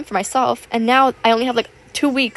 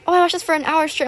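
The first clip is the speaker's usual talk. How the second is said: higher-pitched mock viewer voice; imitated viewer; 7 semitones higher than usual. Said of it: "I see you guys, like, saying".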